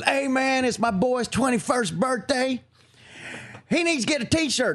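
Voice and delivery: high-pitched imitation of a pushy fan; pleading dad. Father begging for his boy's t-shirt, high pleading.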